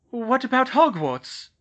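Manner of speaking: high, loud and slow worried mother; mother fearing for the school; fearful. Acted. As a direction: ask with a mother's dread. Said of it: "Molly said fearfully".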